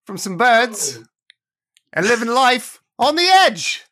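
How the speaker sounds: big upbeat podcast host intro; hyped host welcome over music; announcer intro. Acted. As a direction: sell the show opening like a radio host.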